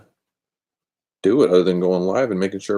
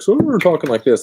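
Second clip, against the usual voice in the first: higher mock voice of someone glancing away; imitating distracted streamers; 9 semitones higher, 4 dB louder than usual. Mocking streamers who look at their screen, higher mock voice. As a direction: mimic people who keep looking at themselves. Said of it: "and they constantly like".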